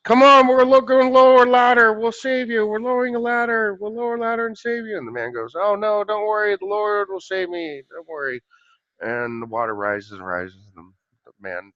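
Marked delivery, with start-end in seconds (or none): 0.0-5.0 high, held monotone loudspeaker call; yelling over a PA. Acted. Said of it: "yelling down on the P A system". 5.6-8.4 calling back reassurance. Acted. Man calls back to helicopter.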